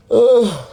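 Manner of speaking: a big, loud yawn escaping mid-rant. Real, not acted. Ranting at Lord Michael Howard, she suddenly yawns loudly. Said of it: "Sorry, I was yawning then".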